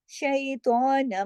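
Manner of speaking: drawn-out chanted reading; slow Quranic recitation. Shown as a demonstration. Teacher recites the phrase for the class. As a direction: recite slowly, each syllable held.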